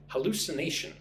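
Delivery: normal talking voice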